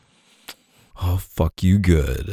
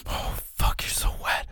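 hushed, unvoiced awe; soft whisper; 8 dB quieter than usual. Lover murmuring to his partner in a hushed whisper. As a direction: breathe it out in a hush.

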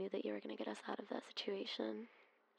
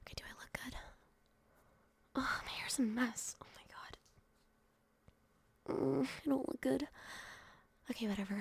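louder, flustered fussing; hurried nervous fussing before opening door; 4 semitones higher, 3 dB louder than usual. Acted. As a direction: panic over messy hair. Flustered about looks.

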